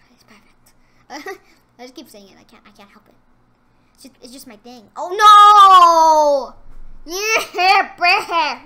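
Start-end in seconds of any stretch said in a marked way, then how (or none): 0.1-0.7 a breathy whisper of "It's perfect". Real, not acted. Murmured repeat of his catchphrase. 4.9-8.6 a loud, high cry of dismay; shocked yell. Real, not acted. Sudden loss in game.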